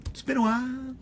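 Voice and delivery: playful high-pitched echo of the line; silly falsetto. Pretend. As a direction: jump way up into a squeaky falsetto. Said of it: "I don't know why I do that".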